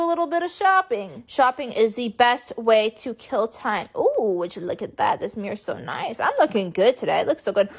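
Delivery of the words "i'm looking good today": ordinary talk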